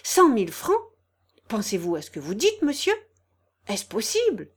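flabbergasted exclamations; astonished cry. A narrator's reading. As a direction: gasp out questions, unable to believe it. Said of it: "s'écria Janille".

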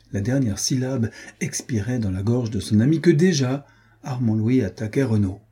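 usual speaking voice

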